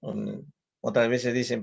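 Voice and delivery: ordinary talk, nothing marked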